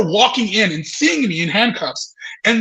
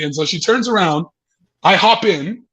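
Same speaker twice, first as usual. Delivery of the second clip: ordinary talk, nothing marked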